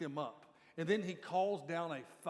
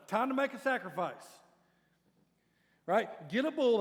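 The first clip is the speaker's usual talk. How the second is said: louder, higher mock challenge; mock taunt; 4 semitones higher, 8 dB louder than usual. Elijah taunting the prophets of Baal, mock loud. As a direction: call it out like a dare. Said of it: "here's what he says".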